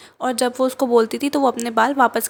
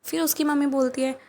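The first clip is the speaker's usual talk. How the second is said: ordinary delivery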